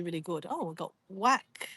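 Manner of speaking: normal talking voice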